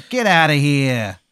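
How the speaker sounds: put-on Jersey accent. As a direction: draw it out in a thick Jersey accent. Jersey Devil orders player away.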